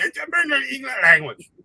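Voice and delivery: put-on high, slurred drunk Scottish accent. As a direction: slur it like a drunk Scotsman. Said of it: "he just would get so shit-faced".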